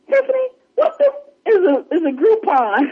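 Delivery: very high, halting disbelief; mock bafflement. Jada asking Tiffany about Groupon, mock baffled. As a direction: imitate being utterly lost by an unfamiliar word.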